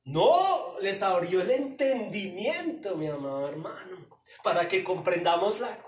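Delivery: loud preaching climax; fiery preaching. Acted. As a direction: hammer the thesis home. Preaching at full force.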